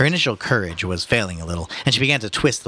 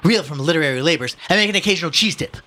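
higher-pitched self-important scholar; pompous son; 8 semitones higher, 3 dB louder than usual. A narrator's reading. Son telling the policeman of his work, pompous. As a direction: recite it grandly in a lifted pitch. Voices Ignatius, second reading.

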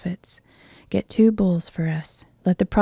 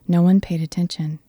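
ordinary delivery, nothing marked

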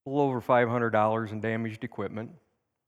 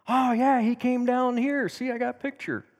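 high, louder girlish chatter, lightly mocked; imitating excited girls; 12 semitones higher, 3 dB louder than usual. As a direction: mimic the girls eagerly showing off their photo. Girls telling about the bear.

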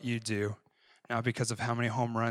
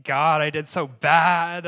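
loud, high, slow anguished cry; a loud mock-despairing cry to God; retold weeping cry; 5 semitones higher, 11 dB louder, slower than usual. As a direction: wail it out like you failed. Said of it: "on the ground weeping".

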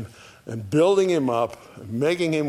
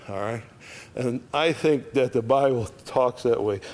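usual speaking voice

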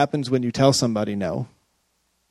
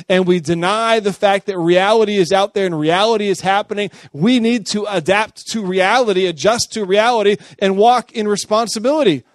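rising, louder preacher's push; preaching; 6 semitones higher, 4 dB louder than usual. Real, not acted. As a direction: drive it home to the congregation. Preaching at full force.

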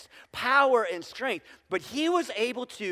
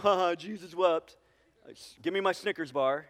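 mock smart-aleck kid with a fake ha-ha; imitated smart aleck; 2 semitones lower than usual. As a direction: toss it off like a cocky smart aleck. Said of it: "we were like smart alecks".

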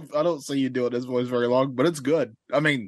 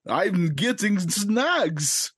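silly slow voice; 4 semitones higher, much slower than usual. Acted. Put-on voice, slow.

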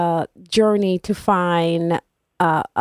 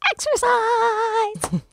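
drawn-out, much higher gushing; excited; 13 semitones higher, much slower than usual. Real, not acted. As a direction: stretch it out high with delight. Gushing about her favorite topic.

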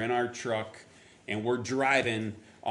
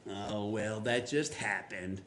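softer, slow mock indifference; imitated dismissive shrug; 3 semitones lower, 6 dB quieter, slower than usual. Preacher voicing a doubter of God's orchestration, dismissive. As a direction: brush it off like a doubter. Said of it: "you could be like".

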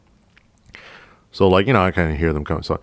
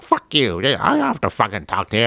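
high, shrill-free mock of a hostile woman, pitched well up; woman brushing off the cop, high mock anger; angry woman's voice; 6 semitones higher than usual. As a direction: imitate her snapping back, much higher than your own voice.

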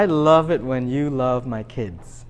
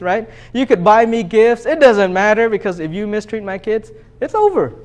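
higher, louder pulpit warning that builds to a peak; fiery preaching; 5 semitones higher, 8 dB louder than usual. Acted. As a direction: preach it like a protective father laying down the law. Preacher voicing a parent's warning.

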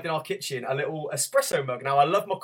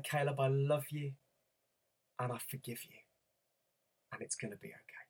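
much softer, fading to a hush; tender reassurance; 2 semitones lower, 13 dB quieter than usual. Retold or imitated. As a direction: murmur comfort to a crying child. Father comforting crying son tenderly.

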